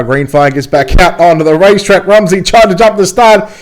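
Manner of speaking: high, loud race call; live play-by-play. Acted. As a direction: build the excitement calling the laps. Excited race call, much higher.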